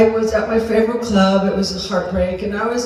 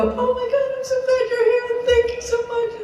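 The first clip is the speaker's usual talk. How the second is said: very high, put-on delighted squeal, kept small; all happy; 14 semitones higher, 3 dB quieter, faster than usual. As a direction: act overjoyed in a tiny high-pitched voice. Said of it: "I just get all happy and go".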